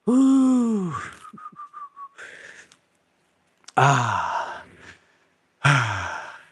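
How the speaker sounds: winded exhales after the set; out of breath. Real, not acted. Coach recovering after push-ups to failure, winded. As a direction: breathe out hard, spent.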